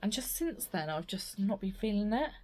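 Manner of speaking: ordinary talk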